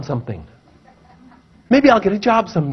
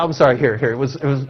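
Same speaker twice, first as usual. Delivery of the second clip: usual speaking voice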